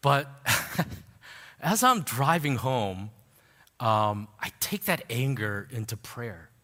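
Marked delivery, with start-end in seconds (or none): none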